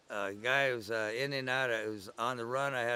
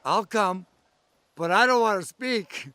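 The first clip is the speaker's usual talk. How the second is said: higher and louder, imitating a nervous eighteen-year-old; voicing the young girl; 8 semitones higher, 12 dB louder than usual. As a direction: squeak it out like the shy new girl. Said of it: "And she goes".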